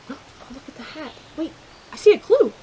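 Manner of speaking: ordinary delivery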